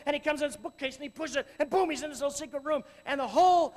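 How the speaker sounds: lively preacher's tale; animated sermon story. Acted. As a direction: paint the adventure with energy. Excited fortress story, preached.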